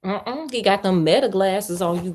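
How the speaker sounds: usual speaking voice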